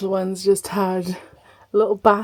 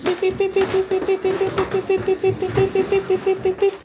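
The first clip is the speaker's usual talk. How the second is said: high, even string of beeps; playful beeping; 10 semitones higher than usual. Real, not acted. Mum carrying all the things at once, high playful beeping. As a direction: beep like a reversing truck, high and steady.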